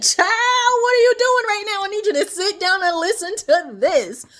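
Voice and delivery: like calling your friend with big news; excited phone call. Acted. She calls the listener on the phone, singsong and excited. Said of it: "and I said".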